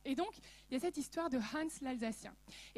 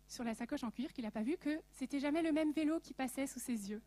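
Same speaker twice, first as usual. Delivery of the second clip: ordinary talk, nothing marked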